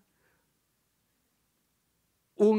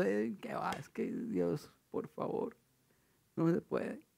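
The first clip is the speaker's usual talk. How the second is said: much softer, half-whispered mock whine; doubting complaint; 10 dB quieter, slower than usual. Preacher mocking believers who doubt God, soft whine. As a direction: deflate into a hopeless little whine.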